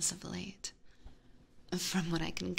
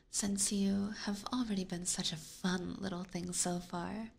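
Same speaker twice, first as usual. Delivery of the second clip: ordinary talk, nothing marked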